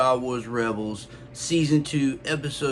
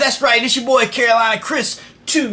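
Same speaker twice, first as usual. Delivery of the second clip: high, loud intro shout; hyped shout; 12 semitones higher, 7 dB louder than usual. Real, not acted.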